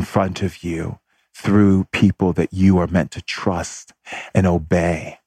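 slow, measured revelation with long pauses; solemn inner voice. Retold or imitated. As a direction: deliver it phrase by phrase, weighing each one. Spirit's answer retold with long pauses.

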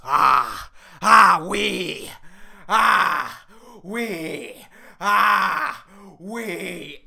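loud mock moaning "ah, oui"; imitating her moans. Imitating a French porn actress, loud mock moans. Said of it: "she was saying it like this".

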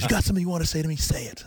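high-pitched mock challenge; insistent needling. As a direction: imitate a coach pushing harder for an answer. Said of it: "he's just needling me".

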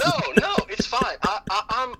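high laughing reassurance. Real, not acted. Father laughing off the host's apology. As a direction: wave it off through laughter.

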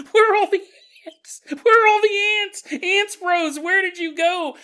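high, loud, bewildered wail; mock panicked yell. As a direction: cry out for lost friends in comic dismay. Confused ghost finds the house cleaned.

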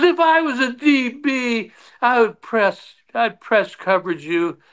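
lightly hammed-up cocky teammate; mock Sparky taunt. Sparky taunts the narrator on field.

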